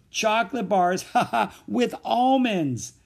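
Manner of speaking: loud, high, gleeful list; high guffawing announcement; laughing shout. A narrator's reading. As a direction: holler it between bursts of laughter. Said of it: "the laughter beginning again".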